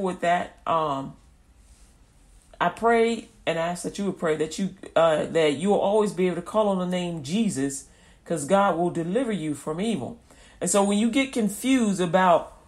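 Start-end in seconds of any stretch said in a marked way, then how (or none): none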